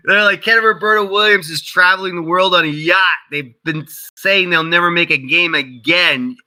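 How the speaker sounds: high, scornful voice of online skeptics; mocking doubters. Twitter skeptics calling him a liar, high mocking tone. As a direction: play the disbelieving crowd, high and sneering.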